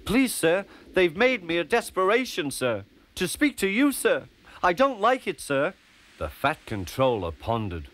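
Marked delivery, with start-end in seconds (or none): 0.0-5.7 high, anxious pleading; flustered little engine, pitched high; nervous. A narrator's reading. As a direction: plead timidly with the boss. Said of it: "You look nervous".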